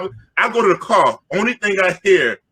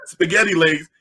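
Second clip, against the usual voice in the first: normal talking voice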